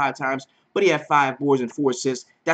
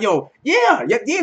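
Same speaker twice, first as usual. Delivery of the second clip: mock hype; 8 semitones higher, 4 dB louder than usual. Viewers hyping Jeff Teague.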